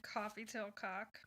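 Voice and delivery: slow suggestive joke; cheeky. Real, not acted. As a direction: drag it out with a smirk.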